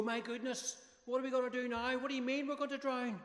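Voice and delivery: high, flustered mock panic. Jesus as he did not react, mock panicky fluster. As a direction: ham up a fussy panicking voice. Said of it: "Didn't go".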